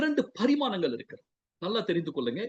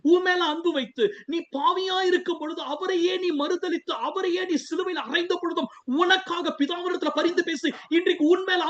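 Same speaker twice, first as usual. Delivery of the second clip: high-pitched, sustained preaching fervour; impassioned preaching; 9 semitones higher than usual. Real, not acted. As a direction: preach it urgently, voice lifted high throughout. Preaches at full force to listeners.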